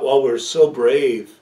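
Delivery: loud, slow, puffed-up self-praise; hammed-up pride at being so brave; mock bravado. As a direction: boast it with a wink. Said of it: "we both walked out and thought".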